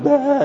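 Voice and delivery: very high imitated whoop of excitement; mock excited cry. Preacher imitating the excited wife jumping up and down, mock excited cry.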